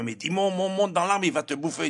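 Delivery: panicked warning. Acted. Belgians in the tree warn their friend, high and urgent. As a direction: warn your friend the crocodile is coming.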